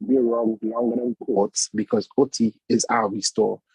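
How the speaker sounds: ordinary talk, nothing marked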